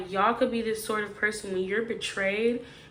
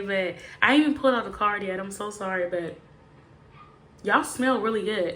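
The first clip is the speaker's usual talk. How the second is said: usual speaking voice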